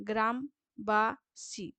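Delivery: গ্রামবাসী very slowly, as গ্রাম, বা, সী; split into syllables. Shown as a demonstration. Teacher showing three syllables, slow and separated. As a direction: break গ্রামবাসী into three spaced beats. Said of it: "তিনটি দল রয়েছে".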